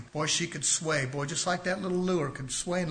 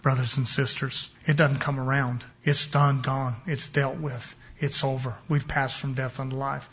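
ordinary talk, nothing marked

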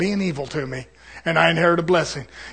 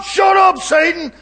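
high, louder stern rebuke; preacher shouting down the tempter, high and louder; shouted rebuke; 10 semitones higher, 4 dB louder, slower than usual. Retold or imitated. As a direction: shout the devil down. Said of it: "And I'm saying".

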